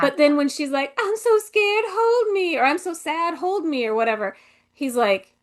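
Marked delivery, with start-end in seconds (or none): 1.0-2.6 much higher, slow, melodramatic plea; mocking Scarlett's clingy plea to Ashley; mock damsel. 2.6-3.9 lighter mock damsel. Mocking Scarlett's variant plea.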